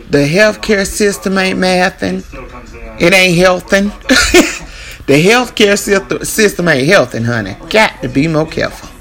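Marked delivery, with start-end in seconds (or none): 4.0-5.0 short airy laugh; quick unvoiced chuckle; breathy laugh. Real, not acted. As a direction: laugh at your own wordplay. Laughing at own joke.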